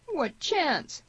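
high, anguished groan of protest. A narrator's reading. Wounded Tierney to the man offering a fight, groaned. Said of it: "groaned Tierney".

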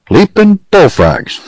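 high, drawn-out exclamation; astonished outburst. A narrator's reading. Pecos learns the judge will fine him, astonished outburst. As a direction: draw it out in sheer disbelief.

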